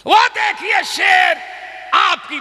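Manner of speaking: high, loud pointing cry; rousing preacher's shout; excited shout. Acted. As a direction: call out as if seeing the lion. Preaching at full force.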